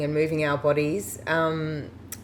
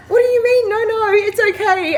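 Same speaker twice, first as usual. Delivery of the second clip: high, louder, puzzled protest; confused surprise; 5 dB louder than usual. Retold or imitated. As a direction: retell her baffled protest up high. Retold confused reply to driver.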